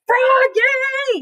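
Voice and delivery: high, loud yell of a name. A narrator's reading. Dad yelling to Froggy in the final minute. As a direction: shout across the field. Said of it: "yelled his dad".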